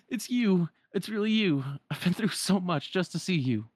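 high, emphatic relief; overjoyed young hero. Acted. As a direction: gush at finally finding your friend. Voices Sora reunited with Namine.

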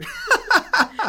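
loud, high laugh; real laughing burst; high burst of laughter. Real, not acted. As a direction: laugh out at the irony. Laughs at own joke.

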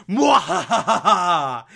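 slow hammy villain laugh; evil cackle. Acted.

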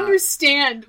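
usual speaking voice

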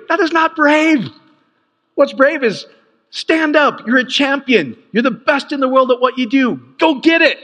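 high, forceful coach-style rally; fired-up pep talk. Acted. Preacher rallies an athlete as a coach would, fired up. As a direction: rouse a champion to action.

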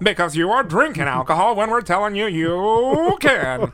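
put-on loud, high old-time newsreel announcer; Prohibition era voice. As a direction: boom it like a 1920s radio announcer. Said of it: "that Prohibition era voice".

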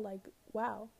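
ordinary talk

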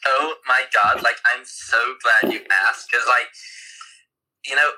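put-on chatty San Diego beachgoer accent. Performing for a listener's request, San Diego accent. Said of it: "I'd quite like to hear a San Diego accent".

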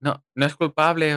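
lightly voiced cheesy screenwriter; a hint of a lazy script excusing the father; mock mediocre writer line. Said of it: "Un guionista mediocre habría dicho".

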